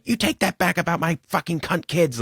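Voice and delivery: high-pitched mock outrage; hammed-up quarrel line; imitated movie argument. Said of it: "goes back and forth, Gleason, like".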